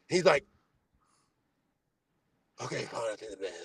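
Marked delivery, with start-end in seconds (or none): 2.6-3.5 low, quiet, mock defeated voice of Mark; grudging surrender. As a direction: do Mark caving in with a sigh. Imitating Mark giving in.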